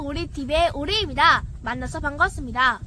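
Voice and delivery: ordinary delivery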